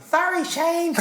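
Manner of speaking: very high-pitched boxer apology; high little voice, imitating the champ; squeaky mock apology from a big fighter; Mike Tyson. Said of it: "Hey, Mike, what's the low shots?".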